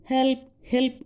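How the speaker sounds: high-pitched mock call for help; mild boy's cry. A narrator's reading. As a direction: imitate a small boy crying out, without real volume. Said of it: "ஒரு சின்ன பையன் கத்தினானே". (சிறுவனின் லேசான கூக்குரல்)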